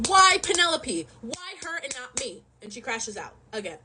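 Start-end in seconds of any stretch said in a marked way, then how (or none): 0.1-1.1 high, drawn-out mock anguish; brink of crashing out. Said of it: "on the brink of crashing out". 1.2-2.4 suddenly soft, high mock hurt; brink of crashing out. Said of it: "on the brink of crashing out".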